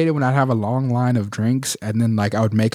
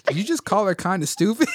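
asked through laughter, pitched up; laughing question; 8 semitones higher than usual. Real, not acted. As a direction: let the laugh break into the words. Retelling a funny exchange.

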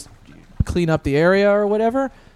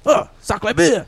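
put-on French accent. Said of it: "Chicken cordon bleu".

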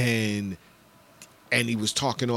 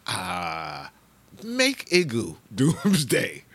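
mock designer; 7 semitones higher, much slower than usual. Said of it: "was like".

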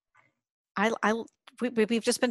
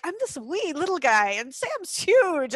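higher, drawn-out comic quote; imitating little guy; 8 semitones higher, slower than usual. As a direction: play up being tiny beside a giant. Retelling a small actor's self-mocking remark.

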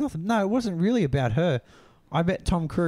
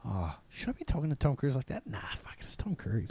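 softer, dropping to an unvoiced hush; inner voice; 5 semitones lower, 11 dB quieter than usual. Retelling a private thought in the dream, hushed inner voice. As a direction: murmur it to yourself in your head. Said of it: "in my mind".

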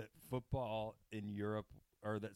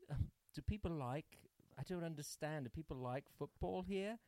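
high-pitched mock posh British voice; posh British accent; 6 semitones higher than usual. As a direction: imitate snooty upper-crust Brits, pitched up. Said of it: "these two British people".

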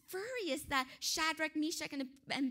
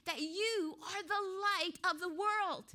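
ordinary talk, nothing marked

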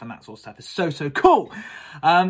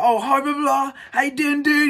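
much higher, loud eager hello; excited greeting; 12 semitones higher, 7 dB louder than usual. Retold or imitated. Saying hi to the guru he admires, high eager gush. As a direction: bubble over saying hi to your hero.

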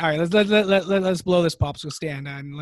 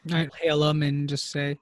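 ordinary talk, nothing marked

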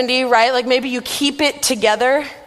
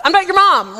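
higher, louder mock-angry retort; angry snap; 7 semitones higher, 5 dB louder than usual. Sleepy roommate snapping at cheerful Erin, mock angry. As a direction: bite back at her, outraged. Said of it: "unreasonably angry with this poor girl".

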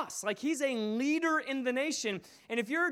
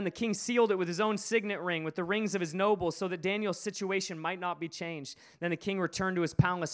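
normal talking voice

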